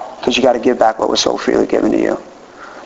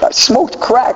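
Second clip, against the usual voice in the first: much higher, blurted punchline voice; deadpan confession; 11 semitones higher, much slower than usual. Retold or imitated. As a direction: blurt it out as the shock reveal. Punchline reply, voice jumps high.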